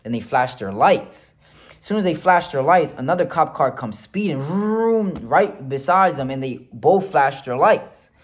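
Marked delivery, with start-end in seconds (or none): 4.4-5.2 vroom like a car racing up; imitated engine vroom. Telling friends a cop car sped in, imitated vroom.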